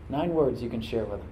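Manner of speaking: ordinary delivery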